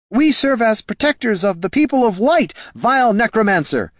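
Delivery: high ringing zealous declaration; righteous priest voice. A narrator's reading. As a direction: proclaim it like a crusading priest. Priest Niln defies the lich.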